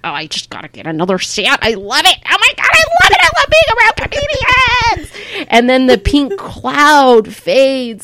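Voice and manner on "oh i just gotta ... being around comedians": high, gushing, breathless newbie comic. Retold or imitated. Comedian mimicking her early years as an eager newbie, high gushing squeal. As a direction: squeal like a thrilled beginner. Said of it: "you're just like".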